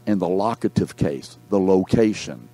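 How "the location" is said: normal talking voice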